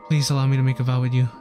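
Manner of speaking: ordinary talk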